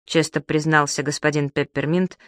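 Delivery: usual speaking voice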